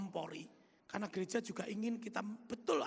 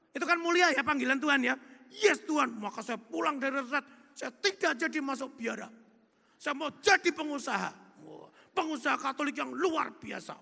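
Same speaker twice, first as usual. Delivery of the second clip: louder, higher, rousing; fired-up; 5 semitones higher, 8 dB louder than usual. Real, not acted. Preacher rallies listeners about his noble calling, fervently. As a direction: proclaim it with burning zeal.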